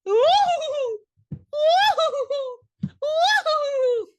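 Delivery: very high, loud, drawn-out wails; long piercing fake sobs; mock wailing. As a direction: imitate hysterical crying, hammed up. Imitates woman wailing in retelling.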